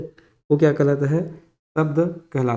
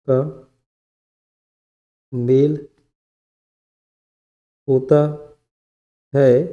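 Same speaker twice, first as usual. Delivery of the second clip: dictation. Real, not acted. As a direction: dictate piece by piece, repeating for note-takers. Dictating definition for students to write.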